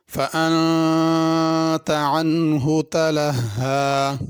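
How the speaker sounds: slow, drawn-out melodic tajweed chant; Quran recitation. Acted. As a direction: recite slowly with long stretched vowels.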